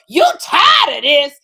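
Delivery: shouted, high, slow sassy echo; hammed-up ratchet outrage; loud sassy repeat. Retelling Kim echoing Tisha's complaint, hammed-up high shout. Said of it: "Kim would go".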